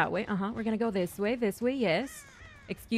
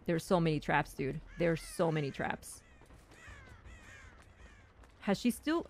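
ordinary talk, nothing marked

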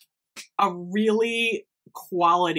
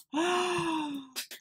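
long, high gasp of delight; gasp, overjoyed; soft, excited intake of breath; thrilled gasp; 10 semitones higher, 7 dB quieter than usual. Real, not acted. Thrilled by surprise.

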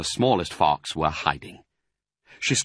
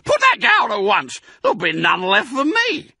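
high, loud squeaky rat scolding; shrill-voiced rodent yelling to guard his cider; angry Rat; 14 semitones higher, 7 dB louder than usual. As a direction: screech at the intruders like a greedy little rat. Rat shouting from his shelf.

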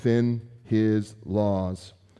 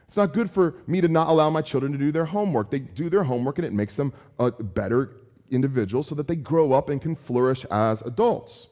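usual speaking voice

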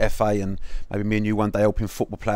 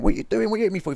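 imitating a startled, much higher protest; mock outrage, pitched way up; 11 semitones higher, faster than usual. Retelling the footballer woken by his wife, startled and high. Startled husband hit in bed.

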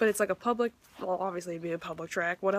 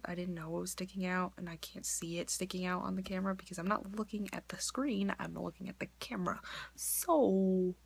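whisper; 2 semitones lower, 7 dB quieter than usual. Real, not acted. Apologizing to viewers.

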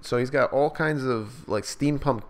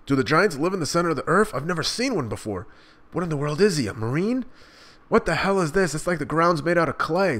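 raised, quick voice of a confused pirate; a pirate puzzling over the clay man, higher and fast; startled pirate; 7 semitones higher than usual. Acted. Reader voicing a bewildered pirate, raised pitch. Reads manga dialogue in voice.

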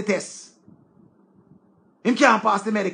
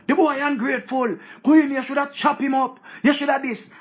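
higher pitched mocking egging voice; mock commenter egging on; 5 semitones higher than usual. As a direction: egg her on like a nosy commenter. Imitating commenters urging Queenie.

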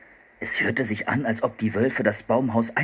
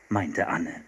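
ordinary delivery, nothing marked

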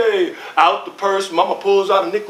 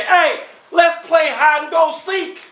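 put-on high shouted kid's call; boy shouting to his friends to play; 9 semitones higher than usual. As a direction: call across the playground like a kid. Kid calling out on playground.

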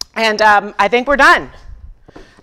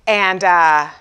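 normal talking voice

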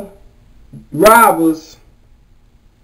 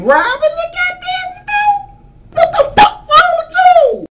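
high yell at a robber taking a call; exasperated yell. Acted. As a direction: shriek at the fool in disbelief. Mocking robber on phone.

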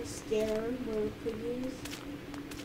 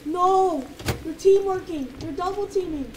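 alarmed shouting; 5 semitones higher, 9 dB louder than usual. Real, not acted. Chased by two enemies.

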